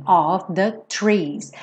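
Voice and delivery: Of said like ov before the trees; Of ending in a v sound. Shown as a demonstration. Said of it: "som de O V".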